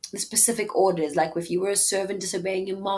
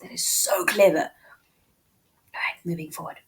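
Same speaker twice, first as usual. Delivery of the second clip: whisper. Real, not acted. Teacher whispering praise of the wordplay, hushed. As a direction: hush the praise, then move on. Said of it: "teacher whispers admiration".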